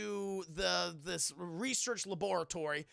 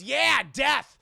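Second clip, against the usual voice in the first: loud, high, blunt yell of the answer; blurted shout; 5 semitones higher, 13 dB louder, slower than usual. Acted. Doctor tells Steve about side effects, loud blunt shout. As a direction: bellow it out with mock cheer.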